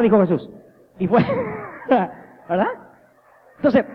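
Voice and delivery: usual speaking voice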